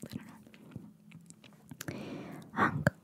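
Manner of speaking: ordinary delivery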